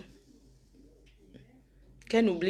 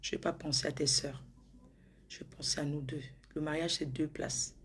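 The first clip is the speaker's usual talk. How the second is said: whisper; 3 semitones lower, 8 dB quieter than usual. Acted. Tender promise to her fiancé.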